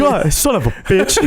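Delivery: lightly voiced cartoon addict; mock drug addict. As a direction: grumble it like a cartoon junkie. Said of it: "And he goes".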